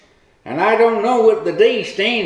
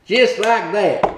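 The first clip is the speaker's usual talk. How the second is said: ordinary delivery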